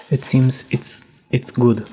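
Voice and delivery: normal talking voice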